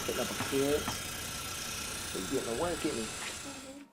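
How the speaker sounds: soft, half-whispered commentary; hushed aside. Acted.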